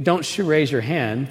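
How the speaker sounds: ordinary talk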